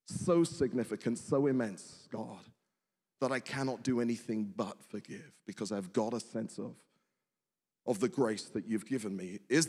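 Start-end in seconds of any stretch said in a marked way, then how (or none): none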